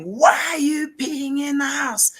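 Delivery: high mock yell at the dog, pushed up sharply; retold angry yell. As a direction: shout it like the angry neighbour across the road. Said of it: "a guy screaming".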